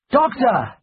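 high, loud call for help; shouted call. A narrator's reading. Returning to the stockade, shouting for the doctor. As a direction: shout it out to someone far off. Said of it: "I shouted".

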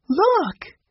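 sudden high excited cry; excited exclaim. A narrator's reading. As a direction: call it out with delight. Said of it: "Jessie exclaimed".

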